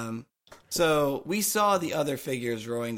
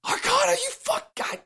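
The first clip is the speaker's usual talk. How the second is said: outraged shriek. Real, not acted. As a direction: shriek in mock fury. Player outraged at roll.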